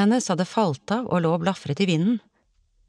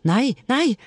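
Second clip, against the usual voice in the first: raised, high-pitched cry; calling out; 7 semitones higher, 3 dB louder than usual. A narrator's reading. A voice heard through the water, calling out. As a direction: cry out in a higher voice. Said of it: "Hun hørte en stemme rope".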